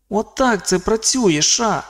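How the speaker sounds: високий дитячий голос у голові; поясни високим хлоп’ячим голосом; голос Ейвері. A narrator's reading. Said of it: "Це Ейвері". (високий голос малого хлопця)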